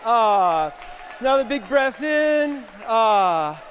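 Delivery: loud, high big breath cue; loud winding down. Real, not acted. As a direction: bring the excited room back. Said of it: "closes the exercise".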